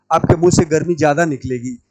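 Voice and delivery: usual speaking voice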